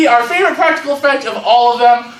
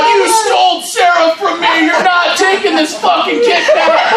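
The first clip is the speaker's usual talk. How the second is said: raised-pitch theatrical accusation; mock angry quarrel; 5 semitones higher than usual. Friend wrestling him on the ground, hammed-up anger. Staged fight between two friends.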